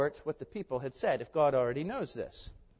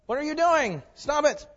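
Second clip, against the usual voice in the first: exasperated people; 10 semitones higher, 4 dB louder than usual. Acted. Angry people confront Moses.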